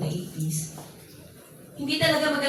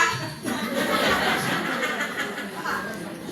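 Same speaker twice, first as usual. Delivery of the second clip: drawn-out happy laugh; long laugh; 5 semitones higher than usual. Real, not acted. Laughing at own story.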